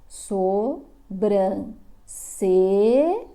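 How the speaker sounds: Sobrancelha said very slowly with a plain la sound, sobrance-la; wrong reading, stretched. Shown as a demonstration. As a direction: drag out Sobrancelha ending in la instead of lha. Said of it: "Aqui não está escrito lha". (leitura errada e arrastada de Sobrancelha, com la no final)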